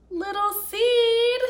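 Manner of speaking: loud, high worried shout. Acted. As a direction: call out as if searching everywhere. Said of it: "he shouted".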